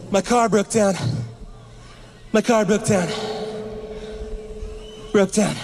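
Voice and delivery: breathless, panicky repeating; frantic. Retold or imitated. Mom telling dad and son about the car, frantic. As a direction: blurt it out in a panic.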